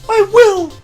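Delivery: very high, loud cry of I will; shouted oath. Acted. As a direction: fling out the vow at full voice. Queen swears her oath.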